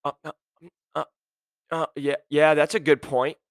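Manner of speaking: imitating a flustered candidate stuck on filler sounds; mock hesitant interviewee scrambling for an answer; stumped, stalling. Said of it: "You don't know how to answer it. You started with, um, like, eighteen times".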